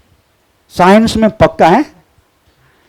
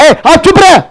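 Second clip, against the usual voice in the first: put-on a touch of a bossy kid, higher; rude child; 7 semitones higher than usual. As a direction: snap at the parents like a cheeky kid. Child hushing parents, acted. (बच्चे की हल्की नकल, माँ-बाप को चुप कराना)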